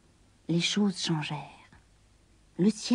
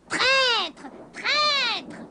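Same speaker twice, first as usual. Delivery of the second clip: higher, raging cry of betrayal; furious witch; 12 semitones higher than usual. A narrator's reading. The witch curses the kitten before vanishing, enraged. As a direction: spit it out as a cheated witch. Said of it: "lança-t-elle".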